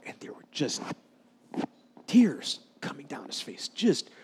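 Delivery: whispered, hushed narration. Acted. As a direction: drop to a whisper for the tears. Moved preacher telling of tears.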